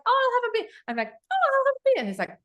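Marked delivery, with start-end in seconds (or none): 0.0-0.7 high-pitched mock-keen acceptance; feigned eagerness. Guest faking keenness for a beer to her interviewee, feigned eagerness. 1.1-1.9 very high, louder mock-keen acceptance; feigned eagerness. Guest re-enacting her unconvincing yes to a beer, feigned eagerness.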